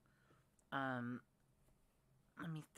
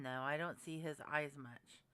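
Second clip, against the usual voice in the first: normal talking voice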